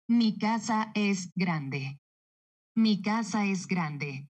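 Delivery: very slow, deliberate "Mi casa es grande"; neutral-accent statement. Shown as a demonstration. Dubbing actress showing the neutral accent, slow deliberate statement. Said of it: "Mantengo este acento que dice".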